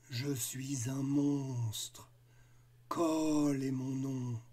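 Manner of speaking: very slow, low and lugubrious; gloomy monster voice. Acted. Monster Cole introducing himself, slow and gloomy. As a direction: drag the words out like a doleful monster. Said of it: "une voix lugubre".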